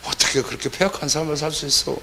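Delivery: whispered sigh, then a judging scoff; mock a pious person clicking their tongue; lightly voiced scornful churchgoer; self-righteous tut. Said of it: "그렇게 생각하는 그 압살롬들의".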